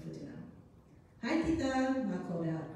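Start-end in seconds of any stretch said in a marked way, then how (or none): 1.2-2.0 high, lilting sing-song greeting. A narrator's reading. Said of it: "in a sing-song voice".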